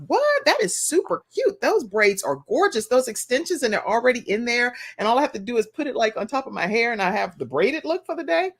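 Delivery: high-pitched delighted gushing, re-enacted; excited gushing. Daughter reliving her delight at the braided hair, excited gushing.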